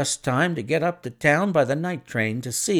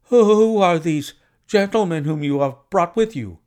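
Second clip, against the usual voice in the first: Pinner voice; 6 semitones higher, 5 dB louder than usual. A narrator's reading.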